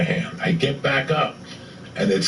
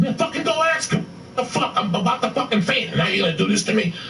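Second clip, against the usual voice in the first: much higher, incredulous mock rant; retold exasperated outburst; 10 semitones higher than usual. About to faint, comic rants at his friend, exasperated. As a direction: act out fed-up disbelief, voice pitched way up.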